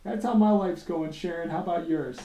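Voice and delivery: mock rant. Angry janitor ranting to coworker Sharon, hammed-up. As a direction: vent like a fed-up janitor at a coworker. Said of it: "I'm like".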